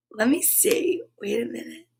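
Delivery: whispered to herself; hushed aside to self. Real, not acted. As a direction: drop to a private whisper.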